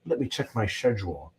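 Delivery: mock British "schedule" said shedule, low and posh-sounding; imitating a British friend, "schedule" with a soft sh; British accent. Mocking UK friends' "schedule" with a British accent. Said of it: "and they say".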